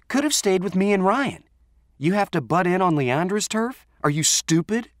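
high-pitched, indignant scolding; angry confrontation. Acted. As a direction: lay into a friend in a high, heated voice. Acted quarrel outside, Renee confronts Allie.

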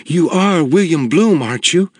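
a small tearful woman pleading; imploring woman. A narrator's reading. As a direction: beg him to say yes. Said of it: "looked at me imploringly".

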